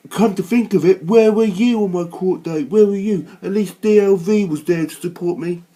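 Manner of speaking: loud, furious shouting; angry shout. Real, not acted. Ranting at Chris Spivey, loud angry shout. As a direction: yell the accusation at him.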